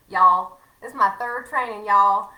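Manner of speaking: loud, playful Southern twang; jokey burst of Southern accent; mock Southern accent. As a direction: lay on the Southern y'all, loudly. Slips into the Southern imitation again.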